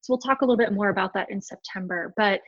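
ordinary talk, nothing marked

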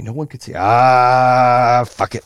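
ordinary talk

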